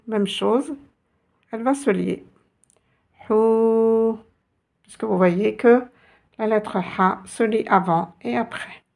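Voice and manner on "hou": "Hou." with a long sustained ou; long vowel. Shown as a demonstration. Teacher shows the letter joining, "Hou." held long. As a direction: stretch "Hou." on one even note. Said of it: "la voyelle longue".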